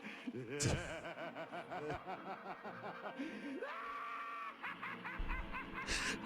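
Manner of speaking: long, soft put-on laugh climbing in pitch; demo laugh. Shown as a demonstration. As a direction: show off a drawn-out, quiet, rising laugh. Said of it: "my laugh should sound something like this".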